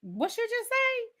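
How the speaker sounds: shocked. Retold or imitated. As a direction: ask it as if you misheard in shock. Mothers shocked at child.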